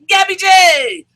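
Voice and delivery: high shouted call; call from the back. Retold or imitated. Someone in the back yelling, high shout. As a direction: yell it out from the crowd. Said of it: "someone in the back goes".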